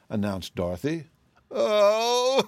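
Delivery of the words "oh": high, drawn-out wavering sob; loud wailing sob. A narrator's reading. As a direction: burst into a theatrical wail. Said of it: "sobbed the woman".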